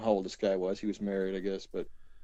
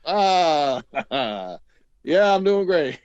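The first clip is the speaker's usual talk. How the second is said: a touch of forced cheer, louder; mock cheerful reply; 4 semitones higher, 6 dB louder, much slower than usual. Mimicking his own fake-cheery answer to the visitor. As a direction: fake it bright and upbeat. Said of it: "I'm like".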